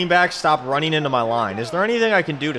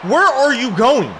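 exasperated yell; 9 semitones higher, 5 dB louder than usual. Real, not acted. Gamer berating Gronk for a wrong route, exasperated yell. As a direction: yell at him in disbelief, stretching the words. Berating his player mid-game.

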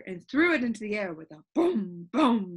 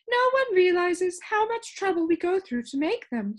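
high, louder grumbling sound keeper; the sound keeper complaining to Milo, high and loud; 9 semitones higher, 5 dB louder than usual. Acted. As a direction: air her grievance in a raised voice. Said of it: "she complained".

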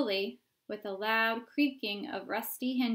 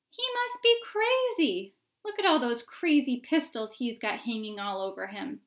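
crowd onlooker; 2 semitones higher, 6 dB louder than usual. Acted.

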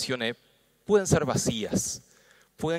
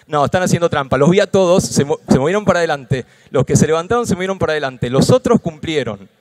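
playful scolding; 2 semitones higher, 10 dB louder than usual. Acted.

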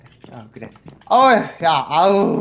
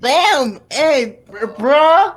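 bro voice; 2 semitones higher, 4 dB louder, slower than usual. Acted. [name] bro greeting.

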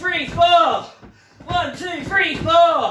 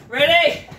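usual speaking voice

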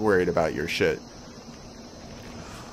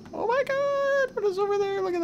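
amazed; 3 dB quieter than usual. Real, not acted. Player spotting a glowing creature, amazed high cry. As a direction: gasp it in awe.

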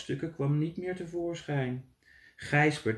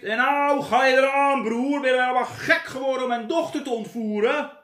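loud raging threat; furious; 9 semitones higher, 7 dB louder than usual. Acted. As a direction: roar at him in fury. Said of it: "zei vuurrood van drift". (de drogist brult woedend tegen Gijsbert)

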